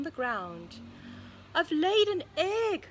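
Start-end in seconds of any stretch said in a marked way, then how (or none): 1.5-2.9 high, loud exclamation from an excited pig; excited cry. A narrator's reading. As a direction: cry it out, higher and louder. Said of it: "cried Pig".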